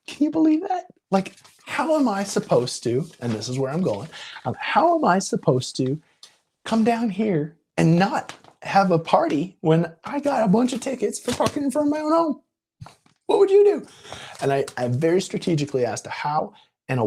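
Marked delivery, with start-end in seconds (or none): 0.1-3.1 much higher, exaggerated disbelief; mock outrage. Mock-outraged complaint to clerk. 4.6-13.9 mock outrage. Mock-outraged complaint to clerk.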